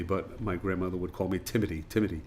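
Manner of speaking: Timothy said as Timoty, hard T with no th sound; Timothy with a plain T, Irish grandmother style; Irish pronunciation of Timothy. Shown as a demonstration. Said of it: "don't pronounce the T H sound".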